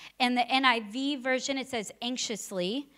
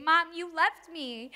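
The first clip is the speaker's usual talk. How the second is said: higher, a lightly voiced little boy; imitating crying child; 5 semitones higher than usual. Mother imitating her left-behind son on the porch, lightly crying child. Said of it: "Noah on the porch crying, saying".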